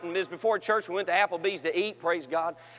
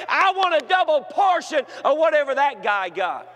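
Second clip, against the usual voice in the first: full-force preaching; 7 semitones higher, 5 dB louder than usual. Acted. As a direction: preach it out to the crowd.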